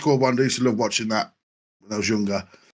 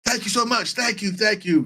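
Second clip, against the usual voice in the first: mock thank you; 8 semitones higher than usual. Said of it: "he's going".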